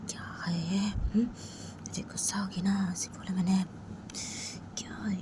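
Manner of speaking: hushed worried whisper; whispered self-talk of a sorry friend; whispering to herself. A narrator's reading. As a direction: mutter under her breath, guilty and fretful. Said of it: "जिया वो अपने ही बोलती".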